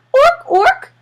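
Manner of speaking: high, loud squawk of triumph; bright, high penguin cry; triumphant penguin ork. Acted. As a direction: crow it like a proud penguin. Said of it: "he said with triumph".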